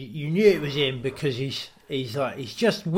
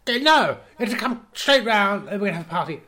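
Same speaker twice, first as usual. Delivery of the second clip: high, fast, loud put-on [name]; mock [name] insisting; 7 semitones higher, 3 dB louder than usual. [name] insisting his friend come round to party. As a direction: rattle it off like a spoilt star.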